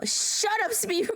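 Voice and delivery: mock yell. Teasing friend in stream chat.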